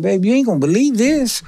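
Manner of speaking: husband phoning his wife, pitched high with excitement; excited. Retold or imitated. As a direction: tell your wife the impossible just happened. Calling his wife with the news.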